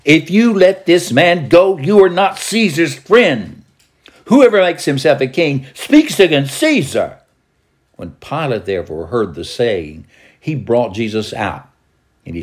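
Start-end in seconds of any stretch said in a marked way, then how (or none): 0.0-7.2 high, loud, insistent accusation; crying out. A narrator's reading. Crowd pressing Pilate not to release Jesus, crying out. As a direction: cry it out as a pointed warning.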